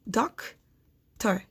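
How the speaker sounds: "doctor" as "doc ... tor", long gap between; doctor, one syllable at a time; split into syllables. Shown as a demonstration. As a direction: break doctor slowly in two. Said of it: "to find the syllables".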